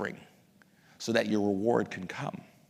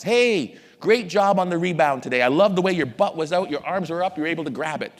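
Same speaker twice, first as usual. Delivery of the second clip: loud, higher-pitched coach's praise; enthusiastic praise; 5 semitones higher, 6 dB louder than usual. Acted. Coach praising a player for a rebound, enthusiastic. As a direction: cheer the player on, big and loud.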